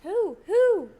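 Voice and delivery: high, loud eager who; eager excited. Real, not acted. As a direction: jump in eagerly. Eager interruption.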